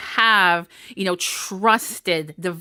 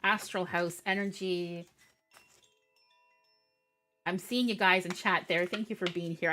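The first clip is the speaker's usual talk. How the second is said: much softer, half to herself; quiet aside; 2 semitones lower, 10 dB quieter than usual. Real, not acted. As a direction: murmur while shuffling cards. Drops voice while switching decks.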